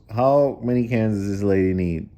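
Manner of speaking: ordinary delivery, nothing marked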